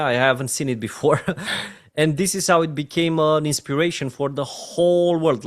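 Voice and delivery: ordinary delivery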